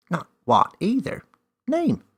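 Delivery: high, loud elf voice; cheeky elf. A narrator's reading. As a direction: correct her playfully.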